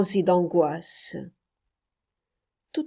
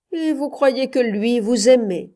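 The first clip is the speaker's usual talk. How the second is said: higher, louder sneering question; a hint of scorn, pitched up; mocking taunt; 5 semitones higher, 5 dB louder than usual. A narrator's reading. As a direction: jeer at her with the question. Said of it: "railla-t-il".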